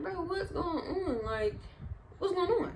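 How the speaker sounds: high-pitched, bewildered pleading; retold scared confusion. Child watching parents fetch tools, scared and confused. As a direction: imitate mild panic, pitch lifted high. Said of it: "Like, I'm scared".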